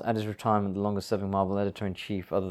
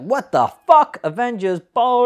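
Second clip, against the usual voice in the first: much higher, loud disbelieving yell; incredulous mock outburst; 12 semitones higher, 11 dB louder than usual. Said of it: "You're like".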